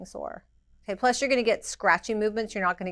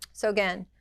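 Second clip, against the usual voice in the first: ordinary talk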